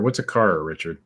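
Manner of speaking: usual speaking voice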